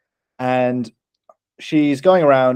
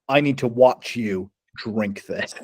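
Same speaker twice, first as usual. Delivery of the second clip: slow, deliberate imitation of an intent clerk; mock intensity; 3 semitones lower, 3 dB quieter, slower than usual. Store guy handing over the drink, mock intensity. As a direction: weigh each word, gravely serious.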